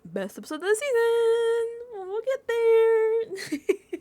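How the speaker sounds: high, slow, loud hype with a laugh; shouting the teaser, laughing at the end; excited hype. Real, not acted. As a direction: sell it like a trailer. Hyping the upcoming live episode.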